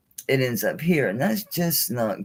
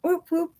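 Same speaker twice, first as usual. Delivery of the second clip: very high, sing-song whoop whoop while moving the toy turtle; squeaky playful toy noises, pitched way up. Pretend. Making the turtle move.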